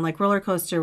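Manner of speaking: ordinary delivery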